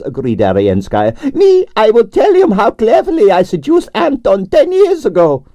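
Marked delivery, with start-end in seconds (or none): 1.3-5.4 grande dame Russian ballerina. A narrator's reading. Narrator voicing Arienskaya, imperious and high. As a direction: sigh it out like a tragic prima donna.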